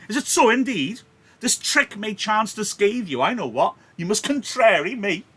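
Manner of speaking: high, loud furious scolding; angry Capulet. Acted. Capulet scolding hot-headed Tybalt at his party, shouting angrily. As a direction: bellow him down, outraged host.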